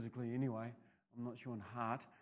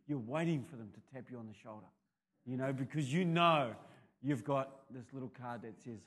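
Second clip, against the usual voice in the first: ordinary talk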